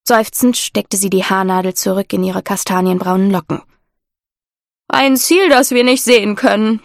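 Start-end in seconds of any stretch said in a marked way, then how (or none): 4.9-6.8 a lightly voiced sailor's grumble; grumbling sailor. A narrator's reading. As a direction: grumble it half to yourself, mildly. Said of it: "brummte Gomio".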